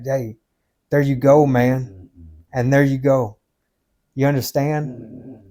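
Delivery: normal talking voice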